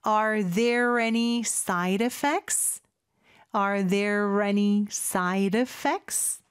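teacher drawing out "Are there any side effects?" with a flapped D in "side"; linked pronunciation demo. Shown as a demonstration. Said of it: "이렇게 되는 거죠". ("Are there any side effects?"를 아주 천천히 이어서, D는 리을처럼)

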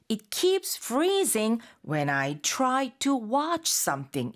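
slow, KEEPS FREEZING and WATCH lifted above the rest; stress on key words. Shown as a demonstration. Teacher showing learners to hit bold words, strong slow stress. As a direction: punch "keeps", "freezing", "try", "watch", dropping on "something". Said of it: "굵게 적혀 있는 단어들을 조금 띄워서 강하게".

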